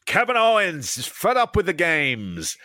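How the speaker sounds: ordinary talk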